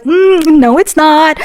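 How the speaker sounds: mm, no, sweetly stubborn; passive-aggressive. Retold or imitated. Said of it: "the little passive aggressiveness".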